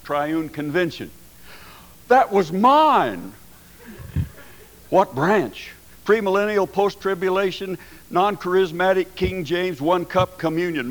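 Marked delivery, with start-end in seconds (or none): 2.1-5.7 slow, loud and high with joy; slow, high, loud delighted reveal. Retold or imitated. Delighted discovery in story. 6.1-10.9 excited listing. Retold or imitated. Rapid excited checklist in story.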